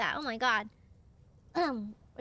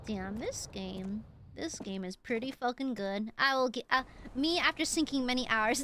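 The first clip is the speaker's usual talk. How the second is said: ordinary talk, nothing marked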